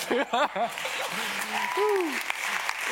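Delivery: loud high laughter; amused laugh. Real, not acted. Speaker laughing with the audience at his Inigo joke. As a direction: crack up at yourself. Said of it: "So good".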